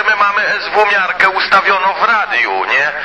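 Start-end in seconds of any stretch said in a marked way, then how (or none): none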